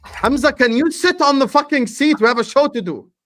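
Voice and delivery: loud, high irritated shout; telling off. Real, not acted. Host scolding Hamza to sit properly, loud exasperated shout. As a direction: snap at him to sit down.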